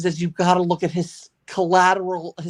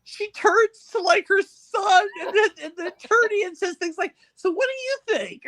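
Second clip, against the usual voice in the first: giggling; 11 semitones higher than usual. Real, not acted. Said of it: "I've got the giggles".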